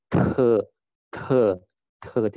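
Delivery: "the" as a T with a strong puff of air; aspirated T. Shown as a demonstration. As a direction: release "the" with audible air after the T. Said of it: "Jadi ada udaranya ya". ("the" dengan hembusan udara)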